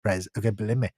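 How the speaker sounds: mock president boasting, loud then dropping down; Donald Trump impression. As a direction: brag like Trump at a podium, pushing it out. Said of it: "He'll say".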